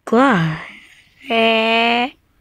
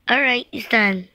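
usual speaking voice

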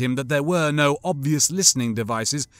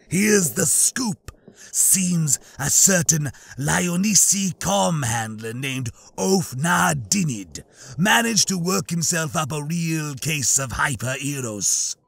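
reptilian alien voice; slower than usual. Acted. Trandoshan Bosk voiced in reading.

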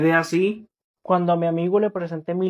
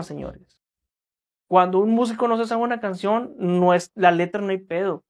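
usual speaking voice

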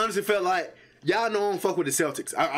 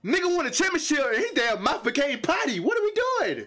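incredulous; 10 semitones higher than usual. Real, not acted. Incredulous at the swearing player.

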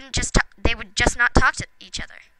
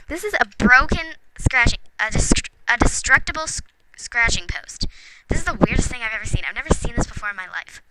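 normal talking voice

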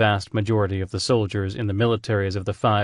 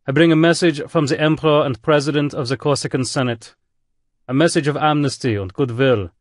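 put-on commanding admiral in a thick German accent; 5 semitones higher, 4 dB louder than usual. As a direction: broadcast a threat with a heavy German accent, higher and louder. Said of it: "The voice had a thick German accent".

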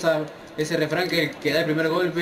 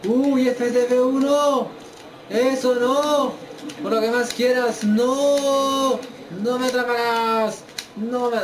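panicked; 9 semitones higher, slower than usual. Real, not acted. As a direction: plead in panic to escape the hold. Opponent applies submission hold.

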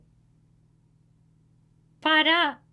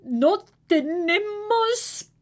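high, louder calling mother; 11 semitones higher, 7 dB louder than usual. A narrator's reading. Mother calling Manuelita over, high loud call. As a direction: call the children like a busy mom. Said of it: "Y mamá dice".

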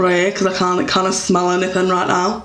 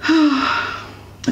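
blissful sigh; 8 semitones higher than usual. Real, not acted. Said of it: "sighs over the scent".

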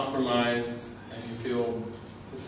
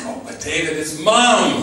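higher and louder, pleading; mock protest; 4 semitones higher, 10 dB louder than usual. A student protesting to David, loud mock plea. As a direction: cry it out like a clinging friend. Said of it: "They say".

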